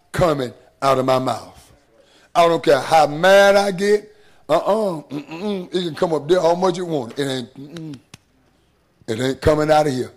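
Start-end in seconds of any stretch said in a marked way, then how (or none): none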